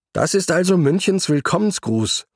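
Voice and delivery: calling out. A narrator's reading. Antje to her riding partner against the wind, calling out. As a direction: raise the pitch as if calling into wind. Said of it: "rief Antje gegen den Wind".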